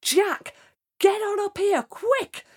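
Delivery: high, urgent princess call. Acted. Princess calls down to Jack from the topmost window, high urgent call.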